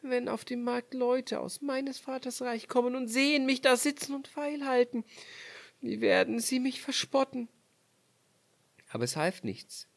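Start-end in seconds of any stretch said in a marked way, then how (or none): 0.1-7.5 deutlich höhere, sorgenvolle Frauenstimme; ängstlich in sich hinein, hoch; Königstochter denkt bang. Acted. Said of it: "dachte sie". (die Königstochter fürchtet den Spott, hoch und bang)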